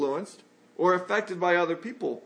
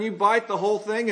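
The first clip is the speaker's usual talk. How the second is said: ordinary talk, nothing marked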